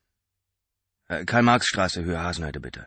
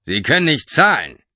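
higher, louder suspicious driver; 8 semitones higher, 11 dB louder than usual. A narrator's reading. Driver suspecting Mark can't pay, suspicious and loud. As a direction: ask it with distrust. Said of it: "fragte er misstrauisch".

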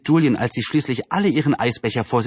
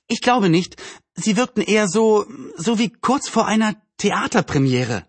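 put-on higher girl's voice, searching for words; voiced Anne, pondering; 9 semitones higher than usual. Anne explains the mood in the town hall, pondering. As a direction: play a girl groping for a comparison.

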